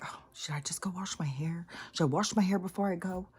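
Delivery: hushed fretting whisper to herself; whispered worry. Retold or imitated. Narrator whispers her midnight hair dilemma to herself.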